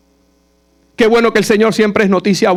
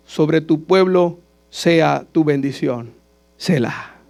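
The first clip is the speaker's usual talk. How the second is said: prayerful scripture reading; 5 semitones lower than usual. Acted. Reading the psalm aloud. (lectura del salmo en voz baja y lenta, como oración)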